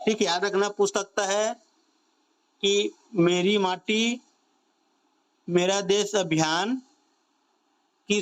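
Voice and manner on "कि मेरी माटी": normal talking voice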